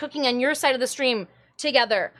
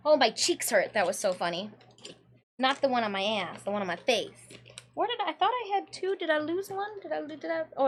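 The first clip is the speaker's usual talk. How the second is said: usual speaking voice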